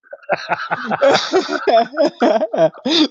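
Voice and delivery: high laughing fit; real laugh; laughter. Real, not acted. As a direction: laugh hard at the joke. Laughing at the joke.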